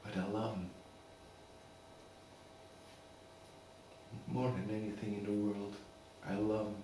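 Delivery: soft, measured, with long pauses; subdued heartfelt plea. Acted. Father pleading to keep his son Billy, subdued and heartfelt. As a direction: quietly make your case as a loving father. Father's monologue in custody case.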